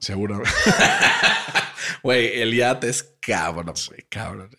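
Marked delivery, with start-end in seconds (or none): 0.5-2.0 high, breathless burst of laughter; real high-pitched cackle; big laugh. Real, not acted. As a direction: crack up helplessly at a joke. Bursts out laughing at yacht joke.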